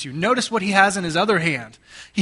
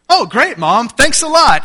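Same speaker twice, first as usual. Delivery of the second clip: much higher, louder mock thanks; sarcastic kids; 7 semitones higher, 9 dB louder than usual. Kids replying to mom about candy, sarcastic. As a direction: throw the thanks back with mock delight.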